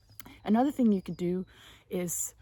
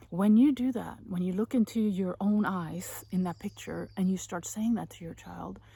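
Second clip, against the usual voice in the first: ordinary delivery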